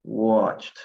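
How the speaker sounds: Watched as one syllable, wotcht, E silent; one-syllable Watched, no vowel before the d; correct pronunciation. Shown as a demonstration. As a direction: say Watched short, ending straight on cht. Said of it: "Yo digo watched".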